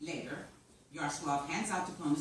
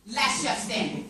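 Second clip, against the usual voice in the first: higher and louder, triumphant title; excited pitch; 8 semitones higher, 8 dB louder than usual. Acted. Writer pitching the winning show title, loud and excited. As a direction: call it out like the final answer.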